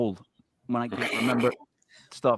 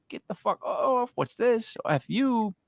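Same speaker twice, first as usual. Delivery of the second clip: high-pitched, indignant mock protest; mock outraged protest; 9 semitones higher, slower than usual. Host imagines guest resisting the abduction, mock outraged. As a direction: act out resisting, pitched right up.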